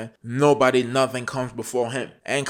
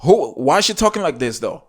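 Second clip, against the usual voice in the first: higher, louder, disbelieving, quick at the end; can't believe what he just heard; real disbelief at the clip; incredulous outburst; 7 semitones higher, 5 dB louder than usual. Real, not acted.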